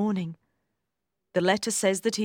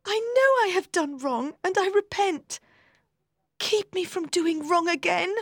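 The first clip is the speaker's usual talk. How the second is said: much higher pleading voice; Maggie, repentant; 11 semitones higher than usual. A narrator's reading. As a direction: beg him to keep her from wrong.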